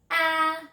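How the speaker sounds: 'Ah' held long on a high level note; short A sound. Shown as a demonstration. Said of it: "One more time".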